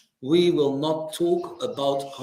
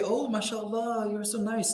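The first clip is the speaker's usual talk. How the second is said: mock flattery; 5 semitones higher, 6 dB quieter than usual. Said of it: "playing a role so that people would say".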